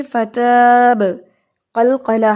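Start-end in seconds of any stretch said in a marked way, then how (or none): 0.0-1.0 فتاب slowly with a bouncing qalqala echo on the final ba. Shown as a demonstration. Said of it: "اینڈ پہ با کی آواز آنی چاہیے".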